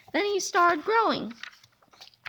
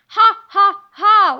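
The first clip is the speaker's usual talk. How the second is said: loud, higher evil ha ha ha; booming villain laugh; 5 semitones higher, 12 dB louder, slower than usual. Acted. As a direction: laugh in triumph over your captives. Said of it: "laughed Sir Stinks-a-Lot".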